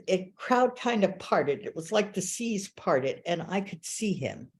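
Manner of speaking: normal talking voice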